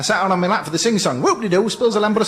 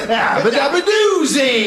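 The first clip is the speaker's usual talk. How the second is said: catchphrase; 5 semitones higher, much slower than usual. Acted. Catchphrase reveal.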